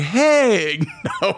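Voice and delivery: high "no way" through a laugh; retold laughing refusal. Laughing at the lady's pastor suggestion, retold amused refusal. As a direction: laugh off the very idea. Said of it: "I started laughing like".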